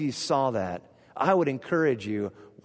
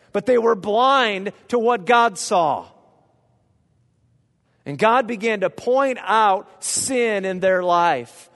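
louder, higher preaching at full force; fervent preaching; 8 semitones higher, 7 dB louder than usual. Acted. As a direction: drive the point home to the congregation.

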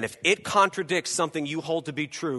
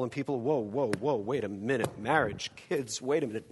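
much softer, lower, half to himself; a hushed, hesitant believer talking himself out of it; hushed inner voice; 4 semitones lower, 6 dB quieter than usual. Retold or imitated. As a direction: murmur the excuses as if confessing.

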